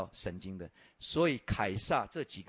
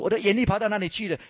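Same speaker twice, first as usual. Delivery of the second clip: higher, louder demand from the Caesar; mock demanding Caesar; 10 semitones higher, 10 dB louder, faster than usual. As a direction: bark it like an offended emperor. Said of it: "凯撒问你说". (模仿凯撒提高嗓门质问)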